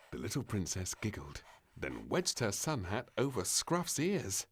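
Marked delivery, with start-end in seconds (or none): none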